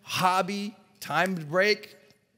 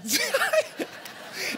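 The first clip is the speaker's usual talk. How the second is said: breathless, very high giggle; airy high laugh. Real, not acted. Speaker laughing at athletes doubting him, airy laugh. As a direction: let a silent laugh escape.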